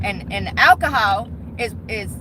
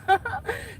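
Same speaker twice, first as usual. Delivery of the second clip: short high soft laugh; brief quiet giggle; 8 semitones higher, 6 dB quieter than usual. Real, not acted. Bartender venting about her life, short laugh.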